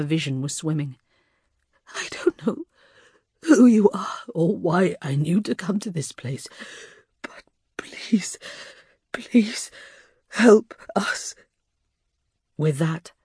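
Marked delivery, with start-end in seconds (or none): 1.9-11.3 wounded, whispered begging; desperate plea. A narrator's reading. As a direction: plead with failing strength. Wounded Neve pleads.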